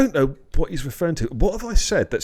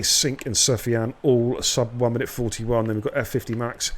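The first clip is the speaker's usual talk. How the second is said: ordinary talk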